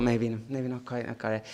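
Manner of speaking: low, quiet retold second thought; doubtful musing. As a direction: let the doubt sink to a soft low murmur.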